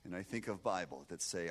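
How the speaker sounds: ordinary talk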